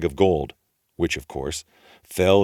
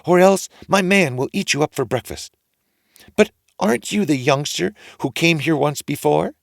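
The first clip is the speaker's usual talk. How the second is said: suspicious wife; 9 semitones higher, 5 dB louder than usual. A narrator's reading. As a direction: question the boy suspiciously.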